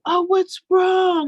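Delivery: mock fussing grandmother; doting grandma fuss. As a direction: coo over the crying child. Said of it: "she's like".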